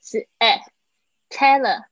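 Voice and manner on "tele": Tele- said loud and high with an open e, not ah. Shown as a demonstration. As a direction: stress the Tele- with a clear e. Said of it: "注意一下你的这个E的发音". (强调“Tele-”的E发音，不是“啊”)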